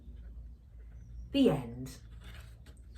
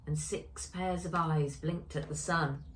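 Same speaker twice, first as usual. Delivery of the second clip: plain reading voice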